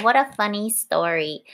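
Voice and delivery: ordinary delivery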